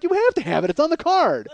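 mock exasperation; exasperated insisting. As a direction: talk down to someone who isn't getting it. Insisting to confused woman.

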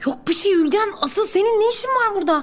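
high-pitched, irritated sisterly scolding; sinirli tersleme. Acted. Abla kardeşini tuvalette görünce tersler. (tiz sesle, sinirli azarlama)

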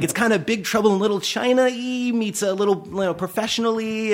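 high, stretched-out explaining voice; over-enunciating to deaf man. Retold or imitated. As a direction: spell it out for someone who can't hear.

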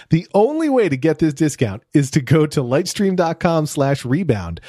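upbeat sponsor read for listeners. Acted. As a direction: read it like a podcast host ad. Host reads sponsor ad.

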